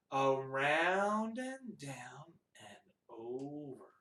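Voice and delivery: slow, low, stretched words; drawn-out tracing. Shown as a demonstration. Teacher guiding children tracing a two, slow drawn-out words. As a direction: stretch each word to match a drawing stroke. Voicing finger tracing a number.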